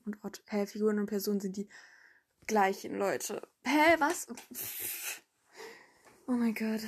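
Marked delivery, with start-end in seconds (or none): 3.6-6.8 lautes, hohes verdutztes Hä; über den eigenen Fehler stolpern; verwirrt. Real, not acted. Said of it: "Verhaspeln im Outtake". (verdutzt und laut über den Versprecher)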